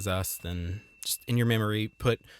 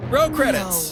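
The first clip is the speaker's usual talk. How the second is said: mock announcer; 11 semitones higher, 6 dB louder, slower than usual. Real, not acted.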